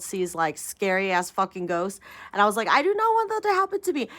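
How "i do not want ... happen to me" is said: put-on high, fast, scared little-kid voice; mock scared child; childlike fear. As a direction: blurt it out like a frightened child.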